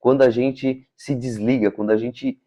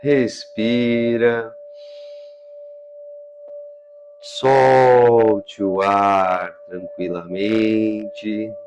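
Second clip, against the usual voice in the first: slow meditation voice with drawn-out words; guided breathing; much slower than usual. Acted. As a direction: lead the breath slowly, stretching each instruction. Leads a breathing exercise. (condução lenta de meditação, respiração guiada)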